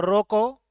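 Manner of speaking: "रोको" held up with a rise, then a break; stop after रोको. Shown as a demonstration. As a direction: halt right after saying रोको. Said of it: "यहां पर ठहराव ले रहा हूं". (रोको बोलकर ठहराव)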